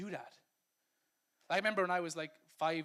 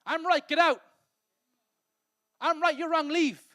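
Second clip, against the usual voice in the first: higher, louder, mock-angry dismissal; a louder, higher mock shout of I'm right, get out; mock angry eviction; 8 semitones higher, 10 dB louder than usual. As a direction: throw them out the door, self-righteous. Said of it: "write someone right out the front door".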